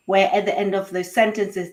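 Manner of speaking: ordinary talk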